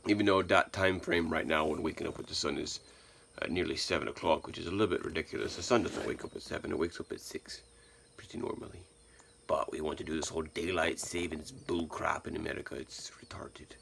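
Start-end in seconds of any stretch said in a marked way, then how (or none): 5.8-13.8 breathy grumbling whisper, fading to nothing. Real, not acted. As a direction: drop to a whisper for the rant. Grumbling aside about daylight savings.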